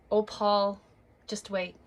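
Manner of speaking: ordinary delivery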